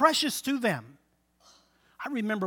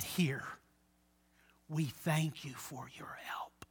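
hushed; 5 semitones lower, 12 dB quieter, slower than usual. Retold or imitated. Mother quietly thanking minister.

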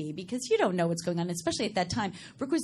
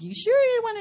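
doubtful parents; 13 semitones higher, 5 dB louder than usual. Retold or imitated. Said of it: "they were like".

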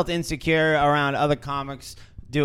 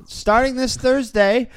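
higher, drawn-out promo voice; announcer plug; 8 semitones higher than usual. Acted. Host plugging an open mic to listeners, announcer voice. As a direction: announce it like an emcee.

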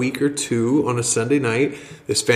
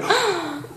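gasp of realization; 4 dB quieter than usual. Retold or imitated. Bob realizing he invited her.

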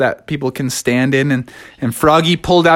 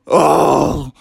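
dramatic relief, climbing higher; grateful sailor Smee; 6 semitones higher than usual. A narrator's reading. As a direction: thank the captain after a rescue.

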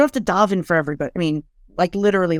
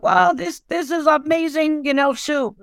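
higher, bright wow over the soup; delighted; 7 semitones higher than usual. Pretend. One sibling at the table raving about soup, high delighted gush.

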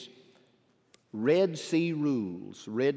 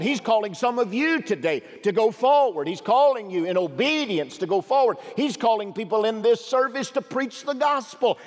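raised, driving pulpit voice; preaching; 7 semitones higher, 6 dB louder than usual. Acted. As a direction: urge them forward from the pulpit. Pastor preaching at full force.

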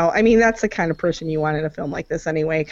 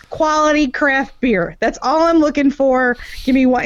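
Tallahassee; 7 semitones higher than usual. Retold or imitated. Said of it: "but you'd be like".

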